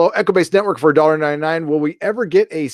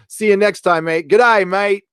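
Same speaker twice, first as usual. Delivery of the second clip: lightly put-on Aussie accent, higher pitched; a cheerful Aussie goodbye to the chat, voiced higher; Australian accent; 7 semitones higher, 4 dB louder than usual. Australian donor, farewell to chat.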